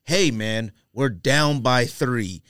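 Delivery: ordinary talk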